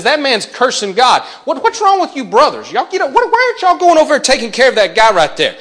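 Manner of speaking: high, loud outraged youth; indignant young David. Acted. Young David scolding his brothers, loud and high. Teen David confronts soldiers.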